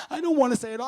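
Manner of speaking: high, loud tearful refusal; acted upset wail; imitating distraught sister-in-law. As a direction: cry out the refusal as her. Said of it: "she's on the line and she's crying".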